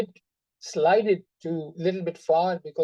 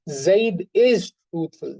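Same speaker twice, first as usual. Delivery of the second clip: "is" hit loud and held long; slow and emphatic on the word "is"; emphasis on is; 5 dB louder, slower than usual. Shown as a demonstration. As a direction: press firmly on "is". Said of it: "that is the meaning of this lam".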